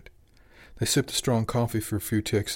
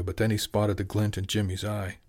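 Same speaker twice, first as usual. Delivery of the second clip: plain reading voice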